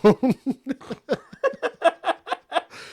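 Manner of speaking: high burst of laughter. Real, not acted. Laughs after the line.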